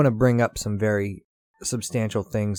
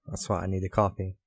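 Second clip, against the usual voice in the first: ordinary talk, nothing marked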